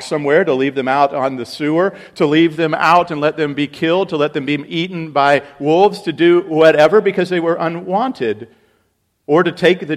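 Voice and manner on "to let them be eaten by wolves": usual speaking voice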